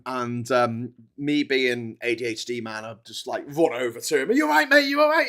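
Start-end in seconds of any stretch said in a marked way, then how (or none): 4.3-5.3 high, loud calling out; frantic high-pitched check, reenacted; acted urgent concern. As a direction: call to a fallen rider, imitated. Acts running over to fallen rider.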